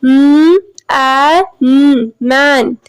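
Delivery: "m, a, m, man" sounded out one sound at a time, then blended; sounding out a word letter by letter. Shown as a demonstration. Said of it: "A as in man".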